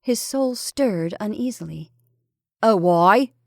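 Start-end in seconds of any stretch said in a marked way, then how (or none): 2.6-3.3 casual question, fairly loud. A narrator's reading. Husband unmoved by wife's confession, casual. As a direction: ask it offhand, without much pitch lift. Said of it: "he said casually".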